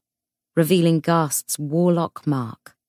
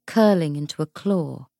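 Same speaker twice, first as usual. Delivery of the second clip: plain reading voice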